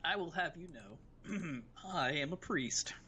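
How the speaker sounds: slow, deliberate, self-important announcement; hammed-up dignified protest; mock indignant declaration. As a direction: declare it grandly, drawing out each word.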